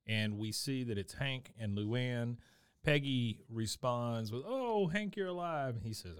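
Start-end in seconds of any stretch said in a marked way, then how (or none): 4.4-5.8 high, relieved cry of joy; relieved gasp. Retold or imitated. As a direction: sound thrilled he's alive. Retelling Peggy's relieved line.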